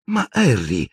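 falter, unsure of the ground; uncertain stammer. A narrator's reading. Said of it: "balbettò Ron incerto". (balbettio incerto)

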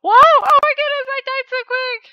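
high, loud cry of disbelief; shocked shout. Real, not acted. Player whose worm died instantly, high shocked shout. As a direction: yelp it like the game just robbed you.

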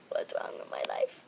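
whispered mock despair. Real, not acted. Whispering to viewers about her life in mock despair.

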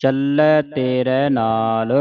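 ਚੱਲੈ ਤੇਰੇ ਨਾਲ slowly, ਚੱਲੈ with a doubled L; slow model line, ਚੱਲੈ held firm; ਹੌਲੀ, ਚੱਲੈ ਕੱਸ ਕੇ; slow tight ਚੱਲੈ. Shown as a demonstration. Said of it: "ਚੱਲੈ ਪਾਠ ਕੱਸ ਕੇ ਬੋਲਣਾ ਹੈ".

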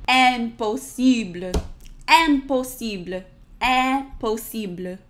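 Impossible with nasal E and French vowels. Shown as a demonstration. Teacher drilling impossible, nasal French. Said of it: "Say it with me".

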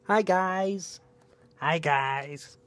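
lightly put-on voice for the pet; a hint of a pet's voice; voicing the pet. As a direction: say hi as if the dog were speaking. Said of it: "Say hi".

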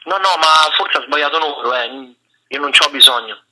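thin phone-line voice; over the phone. Real, not acted. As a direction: heard through a phone receiver. Prank call victim on phone.

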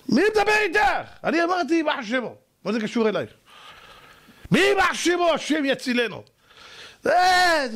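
very high, heated yelling; shrieked exasperation, pitch leaping up; quarrel shouting. Acted. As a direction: erupt in an acted domestic row. Acted husband and wife quarrel.

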